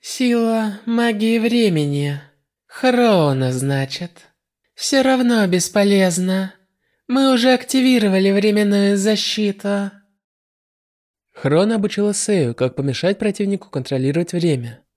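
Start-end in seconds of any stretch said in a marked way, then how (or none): none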